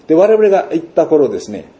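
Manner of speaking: ordinary delivery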